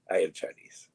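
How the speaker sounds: lightly voiced Jet Li. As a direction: lightly imitate Jet Li, deadpan. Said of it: "and he's like".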